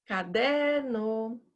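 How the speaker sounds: Caderno, Legal, Bambu, Rosa, each with its stressed syllable held long; slow sing-song calls of Caderno, Legal, Bambu, Rosa; calling the words. Shown as a demonstration. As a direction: hail Caderno, Legal, Bambu, Rosa like names, stretching DER, GAL, BU, RO. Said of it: "como você chama pessoas".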